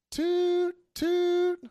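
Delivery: high piercing toot toot; train whistle toot. Pretend. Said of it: "just make the train noise".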